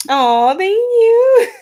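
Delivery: high, loud, slow laughing thank-you; touched and giggling; aw, drawn out through laughter. Real, not acted. Reacting to chat compliment.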